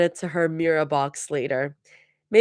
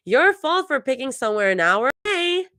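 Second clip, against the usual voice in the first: sing-song; 8 semitones higher than usual. A narrator's reading. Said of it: "Zoe sing-songs".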